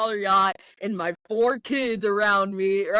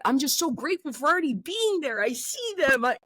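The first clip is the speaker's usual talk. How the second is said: overjoyed; 8 semitones higher than usual. Real, not acted. Overjoyed picturing his dream future.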